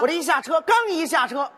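usual speaking voice

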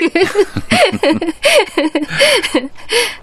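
long, high laugh rising; big amused laugh; hearty laugh. Acted. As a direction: laugh with full agreement. Laughs in agreement.

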